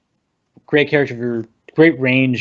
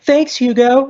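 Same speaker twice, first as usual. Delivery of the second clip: much higher-pitched mock thanks; acted bright thanks; 12 semitones higher, slower than usual. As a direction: toss out a high-voiced thank-you mid-fight. Chirpy thanks acted mid-fight.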